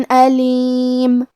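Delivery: علیم۔ drawn out slowly, tanween shown as a clear noon; slow tajweed demo. Shown as a demonstration. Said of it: "بغیر غنہ سے یہ حکم کو ادا کریں گے". (علیم۔ آہستہ، اظہار کے ساتھ)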